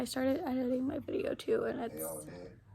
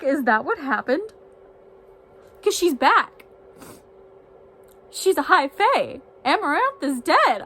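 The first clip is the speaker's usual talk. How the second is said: much louder, higher, shocked rambling; loud stunned disbelief; 4 semitones higher, 11 dB louder than usual. Real, not acted. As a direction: pour out the shock loudly, near frantic. Reacting to chapter events.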